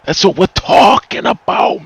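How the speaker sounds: normal talking voice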